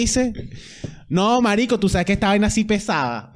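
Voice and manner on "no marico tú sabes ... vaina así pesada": much higher, hammed-up [name] voice; mock [name]. Joking how [name] talks to her fans, high hammed-up voice. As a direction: play [name] gushing to a friend. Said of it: "así habla [name] en inglés".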